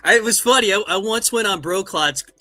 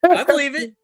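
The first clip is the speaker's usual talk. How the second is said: laughing; 9 semitones higher, 4 dB louder than usual. Real, not acted.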